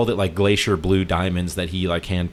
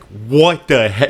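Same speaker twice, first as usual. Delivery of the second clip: drawn-out, higher and louder mock outburst; louder, slow-motion mock disbelief; retold freak-out; 4 semitones higher, 7 dB louder, much slower than usual. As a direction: imitate a friend freaking out, stretching the words. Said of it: "he starts freaking out on him".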